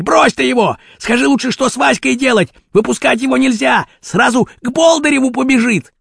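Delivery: high, pressing insistence; urgent argument. A narrator's reading. Rashpil asks what to do with Vaska, urgent. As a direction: urge them, pitch held up. Boss urges Kurochkin in story quarrel.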